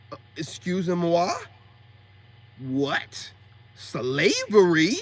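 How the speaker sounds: slow, incredulous questioning; mock disbelief. Reacting to a woman calling marriage slavery, drawn-out disbelief. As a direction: stretch it out in exaggerated disbelief.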